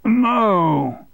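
shouting. Acted. Boss shouting over the shredder noise, curt. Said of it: "he shouted above the noise".

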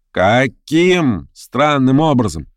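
slow, drawn-out "Каким странным образом"; deliberate, incredulous echo; challenging echo question. Acted. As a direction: throw the words back, stretching each one. Verkhovensky echoes the accusation.

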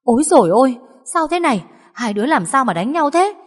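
put-on high alarmed old woman's cry; shocked grandmother. A narrator's reading. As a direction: burst out in alarm then try to part them. Grandmother finds women fighting. (bà hốt hoảng can ngăn)

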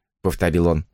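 ordinary delivery

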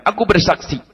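ordinary talk

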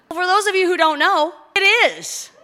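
loud, high proclamation to a crowd; excited announcement. Real, not acted. Pastor revealing a wedding to the congregation, excited announcement. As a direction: build up the big reveal.

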